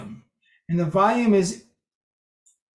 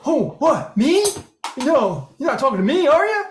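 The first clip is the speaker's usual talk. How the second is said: high, rising incredulous voice; surprised; 6 semitones higher than usual. Retold or imitated. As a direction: act shocked, voice climbing. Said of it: "makes people go".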